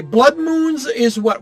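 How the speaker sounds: ordinary talk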